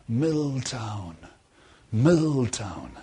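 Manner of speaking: soft far-off call, fading; like a voice calling from far away; distant calling. Retold or imitated. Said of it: "It was calling".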